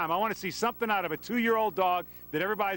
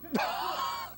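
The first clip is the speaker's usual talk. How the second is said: startled laugh; 3 dB quieter than usual. Real, not acted. Reporter startled by dog run.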